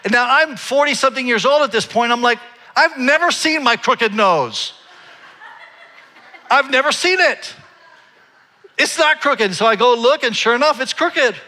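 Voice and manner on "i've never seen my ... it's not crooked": high, comic protest; incredulous denial. Retold or imitated. Man insisting his nose is straight, comically incredulous. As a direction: protest in mock disbelief, pitched way up.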